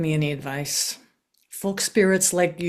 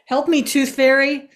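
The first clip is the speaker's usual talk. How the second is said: panicked plea; 6 semitones higher, 5 dB louder than usual. Acted. Hands won't stop, begs for help.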